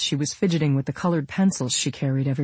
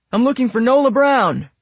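loud, raised announcement; shouted; 9 semitones higher, 6 dB louder than usual. A narrator's reading. Airman searching for Nola Brown, shouted. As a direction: call out for a missing passenger. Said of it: "the airman with the clipboard shouted".

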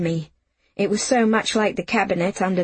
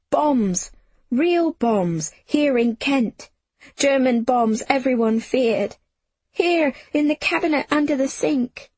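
high, alarmed phrases; panicked narration; 6 semitones higher than usual. A narrator's reading. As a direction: horror rising as it sinks in. Narrator terrified as bombs fall.

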